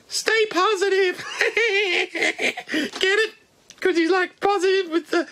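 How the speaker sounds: very high squeaky puppet bat; Wuhan bat voice. Pretend. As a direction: squeak out hellos like a tiny bat. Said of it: "The Wuhan bat".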